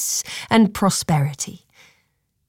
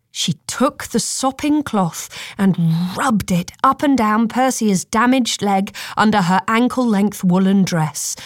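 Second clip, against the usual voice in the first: plain reading voice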